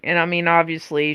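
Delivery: normal talking voice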